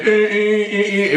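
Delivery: slow mock honking noise; drawn-out imitation of horns blaring; imitated car horns. Horns held up by the parked car, lightly imitated honking. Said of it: "I hear the".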